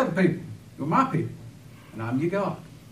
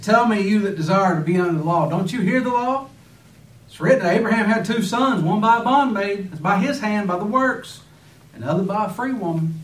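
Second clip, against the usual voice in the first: louder and higher, quoting Paul with fire; fiery preaching; 5 semitones higher, 5 dB louder than usual. Acted. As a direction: preach the scripture out at full force. Preacher quoting Paul at full force.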